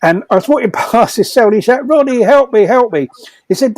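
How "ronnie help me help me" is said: higher, hammed-up cry for help; big bloke squealing for help; mock panicked plea. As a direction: play the terrified cellmate begging. Retold frightened inmate pleading.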